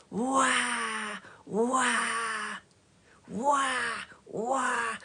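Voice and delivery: put-on high, repeated baby crying wah; imitated baby wail. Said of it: "just like that".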